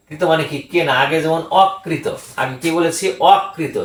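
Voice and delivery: ordinary delivery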